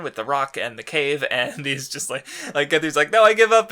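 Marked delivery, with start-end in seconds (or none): 3.1-3.7 high, loud whiny surrender cry; mock surrender. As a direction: yell it like a beaten villain throwing in the towel. Villain giving up in retold fight.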